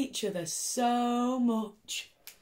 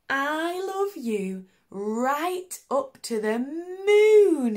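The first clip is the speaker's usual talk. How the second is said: high, slow and loud; drawn-out wonder; 7 semitones higher, 8 dB louder, much slower than usual. A narrator's reading. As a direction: stretch it to the moon. Little Hare's drowsy big declaration.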